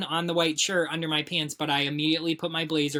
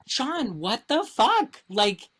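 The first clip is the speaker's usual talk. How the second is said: much higher, slow mock-outraged squeal; mock exasperated husband; 12 semitones higher, 4 dB louder than usual. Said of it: "And he's like".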